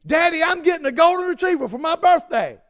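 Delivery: put-on high, loud little-girl announcement; mock little daughter proclaiming a birthday wish; excited little girl. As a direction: proclaim it like a thrilled child. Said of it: "she proclaimed to me".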